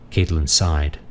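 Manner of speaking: ordinary delivery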